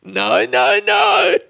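high, squeaky protest through laughter; helpless laughter. Real, not acted. Podcaster scolding themself mid laughing fit, helpless high squeals. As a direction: plead no while cracking up, voice shooting up.

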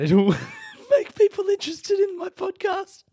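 squeaky high words through laughter; cracking up at the idea; laughing. Real, not acted. Laughing at her own podcast scheme, squeaking through giggles.

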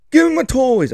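put-on high demanding kid voice; whiny kid demand. Son demanding his toys from dad, high whiny kid voice. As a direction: whine for the toys like a small boy.

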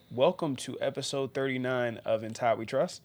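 ordinary talk, nothing marked

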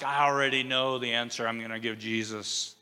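soft, self-satisfied know-it-all aside; smug top student. Retold or imitated. Peter as the eager know-it-all, murmured smugly. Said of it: "he's trying to be like the, you know, valedictorian of the class".

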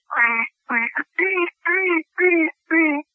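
vocal quacking imitation; imitated duck quacks. Shown as a demonstration. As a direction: quack like a hen mallard. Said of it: "like that, that's a California squeal".

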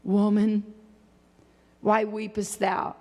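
low, measured scripture voice; solemn quoted question. Retold or imitated. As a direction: drop low and pause after the first word. Quotes the question asked of Mary.

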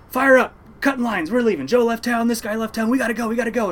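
high, loud captain's call; acted-out hollering, every phrase urgent; urgent shouted orders. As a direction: rouse the crew to cast off now. Captain rousing crew to leave now.

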